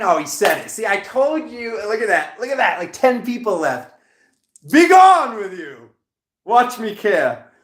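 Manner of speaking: loud, high-pitched, worked-up rant; fired up, loudly dismissing objectors; riled outburst. Real, not acted. Reader telling off departing viewers, loud riled outburst.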